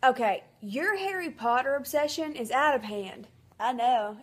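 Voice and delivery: usual speaking voice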